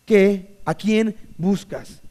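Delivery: drawn-out probing question; slow emphatic question. Shown as a demonstration. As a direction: let it sink in slowly. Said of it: "perspectiva correcta".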